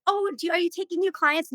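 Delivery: high-pitched hopeful request, re-enacted; eager asking. Would-be client pesters dog walker daily, eager asking.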